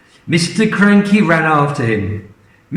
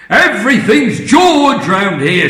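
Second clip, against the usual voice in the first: high, louder sneer; resentful grumble; 7 semitones higher, 5 dB louder than usual. Acted. Grandma grumbling about George, loud resentful. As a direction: spit out the resentment. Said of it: "said Grandma".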